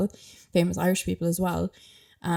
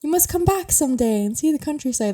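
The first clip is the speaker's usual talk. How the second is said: Irish woman humouring a Dublin tourist, forced cheer; polite; 9 semitones higher than usual. Retold or imitated. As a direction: gush politely while hinting otherwise. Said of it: "trying in a polite way to say".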